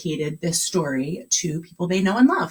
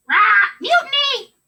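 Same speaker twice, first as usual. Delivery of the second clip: much higher, shouted parrot cry; parrot squawk; 13 semitones higher, 6 dB louder than usual. Acted. Parrot on the pirate's shoulder squawks mutiny.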